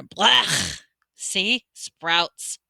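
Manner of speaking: grossed out by a sprout bean; boy tasting a sprout-flavoured bean; disgusted blech. Acted. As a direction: spit out a retching blech. Ron bites bad bean.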